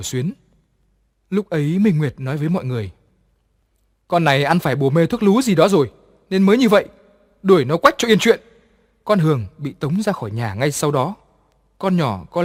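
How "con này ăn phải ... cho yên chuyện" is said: loud, higher scornful order; harsh dismissal. A narrator's reading. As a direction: bark the order to throw her out. Mistress dismisses the maid loudly.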